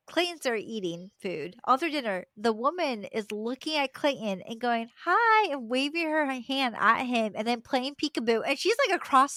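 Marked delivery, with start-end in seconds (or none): 5.0-5.5 put-on high, louder baby-directed hello; cooing greeting. Older woman waving at a toddler across the restaurant, high and cooing. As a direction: imitate a stranger fussing over a toddler.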